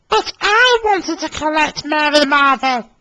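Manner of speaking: high, whiny engine complaint; high, disappointed moan; moaning Emily. Acted. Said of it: "moaned Emily".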